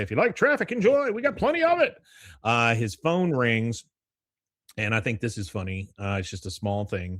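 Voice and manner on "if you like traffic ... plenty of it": high, hammed-up traffic announcer; radio announcer. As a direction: play a peppy radio host, pitched way up. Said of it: "you hear the radio announcer say".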